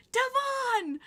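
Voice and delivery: high-pitched imitated holler; mock fangirl yell; Taylor calling out. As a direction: call the name out to someone far off. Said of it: "my friend Taylor goes".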